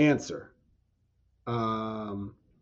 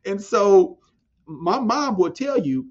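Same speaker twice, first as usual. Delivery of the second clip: ordinary delivery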